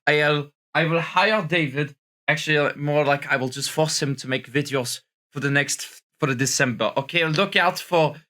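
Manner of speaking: hammed-up French accent on English; put-on French accent. French persona greeting his viewers, in a put-on French accent. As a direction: play a tired Frenchman mangling English. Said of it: "this French accent".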